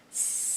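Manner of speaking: long unvoiced snake hiss; steady breathy hiss like a snake. Shown as a demonstration. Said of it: "take that S snake sound all the way down".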